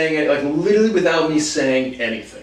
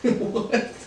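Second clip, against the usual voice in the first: a high laughing What?; laughing disbelief; 8 semitones higher, 5 dB quieter than usual. Real, not acted. Recalling his partner taking the hard part, laughing What?.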